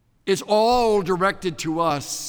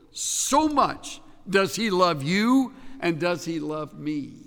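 ordinary talk, nothing marked

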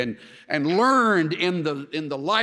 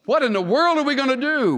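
higher, louder cry of alarm; mock panic; 8 semitones higher, 6 dB louder than usual. Trapped Israelites wonder what to do, mock panic. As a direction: exclaim as if mildly panicking. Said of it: "They panicked".

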